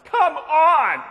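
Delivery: loud, high exasperated shout; yelling. Retold or imitated. Shopper holding cleaning supplies at price check, exasperated yell. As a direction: yell it out as an impatient customer. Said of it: "just yells".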